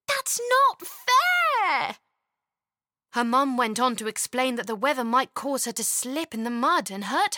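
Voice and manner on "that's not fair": put-on high, loud, drawn-out child's protest; indignant child. Chloe protesting to her mum, high and loud. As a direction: shout it like a wronged little girl.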